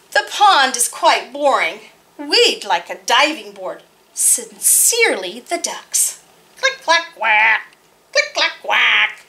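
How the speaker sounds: put-on lively read-aloud for kids; story narration. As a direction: tell it brightly to a room of children. Reads picture book aloud.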